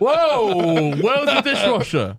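high-pitched, hammed-up indignant protest; comic alarm with laughter creeping in; mock outrage. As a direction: play a scandalised partner, pitched right up. Acted outrage over the dishwasher gift.

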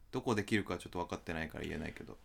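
normal talking voice

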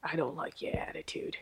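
low, quiet gruff boss. Retold or imitated. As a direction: play a stern tough boss. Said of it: "He doesn't really sound like that".

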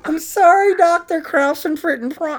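high, meek sorry to the doctor; apologetic patient. Pretend. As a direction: whimper an apology to the German doctor. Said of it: "Some German doctor".

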